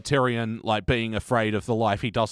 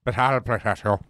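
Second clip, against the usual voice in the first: lightly imitated odd, placeless accent; a hint of a weird accent from nowhere; Littlefinger accent. Littlefinger promising Sansa protection, weird placeless accent. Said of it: "In his weird in-, little finger accent".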